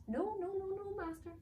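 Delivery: very high elf squeak of denial; flustered servant; panicked elf. Acted. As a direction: elf denying frantically to master.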